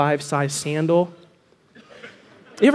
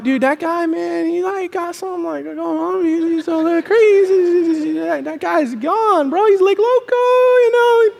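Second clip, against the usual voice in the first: high, put-on dude voice, drawn out; mock bro amazement; baffled onlookers; 9 semitones higher, slower than usual. Acts onlookers baffled by Josh.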